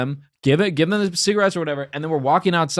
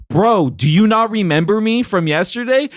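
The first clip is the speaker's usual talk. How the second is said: raised-pitch, indignant disbelief; incredulous confrontation; 7 semitones higher, 4 dB louder than usual. Retold or imitated. Confronting the man who took his cigarettes yesterday, incredulous. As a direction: confront him in retold disbelief. Retold confrontation outside comedy shop.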